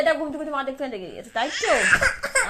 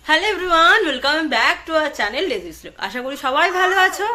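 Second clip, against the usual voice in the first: vlog intro; 4 dB louder, slower than usual. Acted. Vlog channel greeting.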